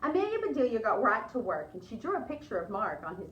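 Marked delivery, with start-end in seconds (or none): none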